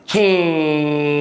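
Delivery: long ringing "ciiing"; sustained imitated noise; imitated sizzle. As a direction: draw the sound effect out and let it ring. Imitates sound in fish story.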